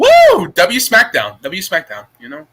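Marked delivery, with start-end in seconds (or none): 0.0-0.5 high whooping wrestling woo; mock woo cheer. Friend cheering about SmackDown, high playful woo. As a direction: let out a Ric Flair woo.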